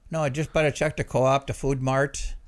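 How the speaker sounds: put-on emphatic Minnesota accent; put-on Minnesota errand story. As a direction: tell it like a chatty Minnesotan. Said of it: "the Minnesota voice there".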